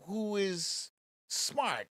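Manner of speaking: ordinary delivery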